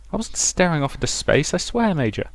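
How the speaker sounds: defensive protest. Acted. Scolded officer protests defensively.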